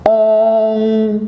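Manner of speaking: اون stretched very slowly as a nasal "oon". Shown as a demonstration. Teacher repeating the nasal vowels, very slow nasal "oon". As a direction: hold اون long through the nose. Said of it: "ناک سے sound جس طرح".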